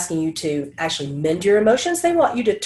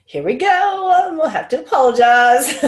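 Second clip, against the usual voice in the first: mock dread; 7 semitones higher, 5 dB louder than usual. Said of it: "And I'm like".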